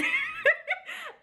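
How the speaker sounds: high giggle; real laugh. Real, not acted. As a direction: giggle at how absurd it is. Laughs at cousin's age.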